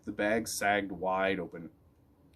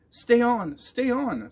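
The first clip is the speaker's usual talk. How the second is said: high loud excited cheering; dog cheering the boy on; 13 semitones higher, 7 dB louder, much slower than usual. A narrator's reading. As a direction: urge him on excitedly. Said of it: "Spook barked excitedly".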